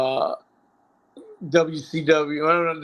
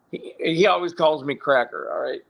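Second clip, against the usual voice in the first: ordinary talk, nothing marked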